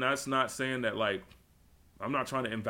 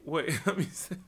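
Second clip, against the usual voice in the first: laughing; 4 semitones higher than usual. Real, not acted. Breaks up laughing mid-bit.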